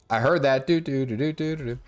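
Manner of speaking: ordinary talk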